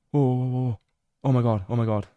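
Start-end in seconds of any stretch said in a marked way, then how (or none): none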